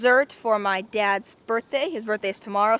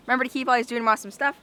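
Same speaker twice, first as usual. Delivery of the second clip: fast; much faster than usual. Real, not acted. Rushed sign-off.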